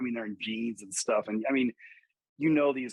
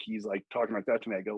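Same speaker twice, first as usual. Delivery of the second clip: normal talking voice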